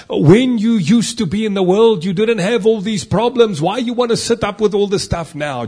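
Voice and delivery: high, loud mocking devil; tempting devil voice. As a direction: taunt like the devil luring him away. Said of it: "that voice".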